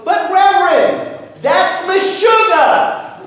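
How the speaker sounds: loud, high, drawn-out objection; incredulous protest. Acted. Farmer objects to bringing the cow indoors, incredulous protest. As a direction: stretch the words out in comic disbelief. Man protests the absurd advice.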